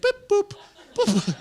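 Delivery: high electronic beeping; crosswalk beep. Retold or imitated. Comic imitates a crosswalk signal with a high electronic beep. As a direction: chirp like a street signal. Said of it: "The street thing's like".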